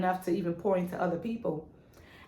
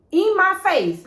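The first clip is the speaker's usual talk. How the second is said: emphatic; 11 semitones higher, 8 dB louder, slower than usual. Real, not acted. Emphatic last straw.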